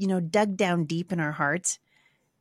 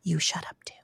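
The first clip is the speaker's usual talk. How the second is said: exasperated whisper to a husband; whispered retort; 2 semitones lower, 6 dB quieter than usual. Retold or imitated. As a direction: whisper it, fed up. Snapping back at husband in bed.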